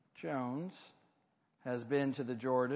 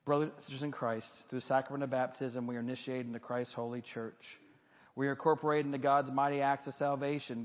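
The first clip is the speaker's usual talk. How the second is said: formal liturgy reading; 3 semitones higher, 3 dB louder than usual. Acted. Leads baptismal reaffirmation liturgy.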